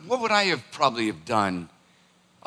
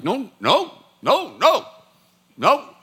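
higher, firm mock-parental scolding; stern scolding; 5 semitones higher, 4 dB louder than usual. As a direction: scold her like a naughty child. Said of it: "I'm like".

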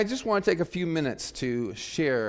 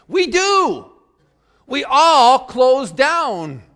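much higher and louder, dragging out we all close down; emphatic exclaim; 10 semitones higher, 12 dB louder, much slower than usual. Retold or imitated. Quoting the priest's emphatic warning, loud exclamation. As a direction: shout it like it ends in exclamation marks. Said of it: "kind of emphatically says".

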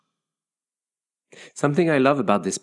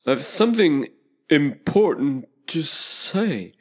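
calling out; 7 semitones higher, slower than usual. A narrator's reading. Caterpillar calls her back.

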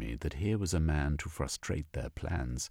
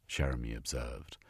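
plain reading voice

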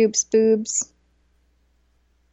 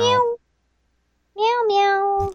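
cat meow; 9 semitones higher, much slower than usual. Pretend. Imitating the cat.

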